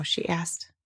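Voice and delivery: ordinary delivery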